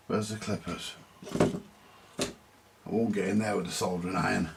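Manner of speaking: ordinary talk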